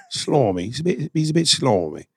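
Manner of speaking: playful put-on English accent on slimy bloke; mock British accent. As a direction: ham up a London bloke, slimy said slarmy. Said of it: "you're ready for your English shit?".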